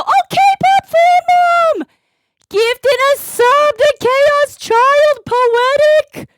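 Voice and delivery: very high, loud, drawn-out cheer; elated shout-out. Real, not acted. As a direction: belt out the thanks, stretching every word. Celebrating a viewer's gifted sub.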